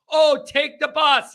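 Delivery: mocking. Mocking suggestion to take bus.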